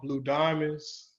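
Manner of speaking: ordinary talk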